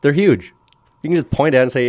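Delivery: high, loud, incredulous rant; worked-up but joking complaint; comic indignation. Real, not acted. Comic rant at explorer's false claim.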